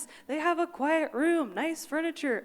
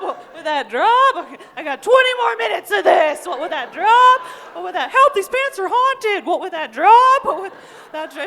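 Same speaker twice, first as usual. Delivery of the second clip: loud, high laughing taunts; laughing, shouted mock bravado; loud self-hype; 5 semitones higher, 11 dB louder than usual. Real, not acted. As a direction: crow at the crowd through laughter. Heckling self, crowd hype.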